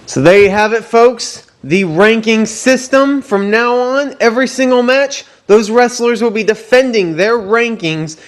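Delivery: high, hyped ring announcer voice; wrestling promo. Acted. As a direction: sell it like a big fight night. Hyped wrap-up of the rankings.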